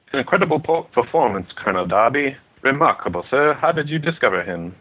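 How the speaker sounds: impressed British upper-class gent, badly faked accent; British gentry accent. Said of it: "Do you know how to do British?".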